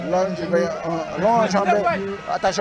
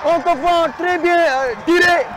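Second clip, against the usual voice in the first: excited sports commentary; 8 semitones higher than usual. Real, not acted. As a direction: shout the goal with joy. Commentator calls a goal.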